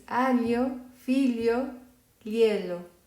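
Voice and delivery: aglio, figlio, lielo with gl like li; Italian gli sound. Shown as a demonstration. Teacher models the gli sound for beginners. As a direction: say aglio as alyo. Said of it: "a pronunciar para nosotros".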